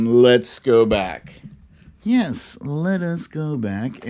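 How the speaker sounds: put-on high, slow read-aloud children's book voice; storybook reading. Streamer reading a parody children's book to viewers in a high, slow storybook voice. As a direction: read it like a bedtime picture book, sing-song.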